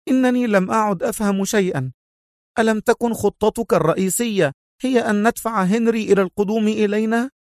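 high, agitated woman's voice; sharp exclamation. A narrator's reading. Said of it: "هتفت في حدة".

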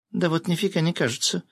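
high-pitched female indignation; indignant. A narrator's reading. Former bearded man, now a woman, indignant. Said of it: "возмутился бывший бородач".

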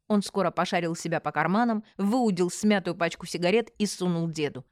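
ordinary delivery

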